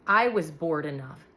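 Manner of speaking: plain reading voice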